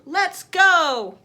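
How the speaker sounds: loud, high shouted command; rally the gang loudly. A narrator's reading. Big boy shouts to gang.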